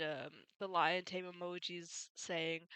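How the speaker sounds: ordinary talk, nothing marked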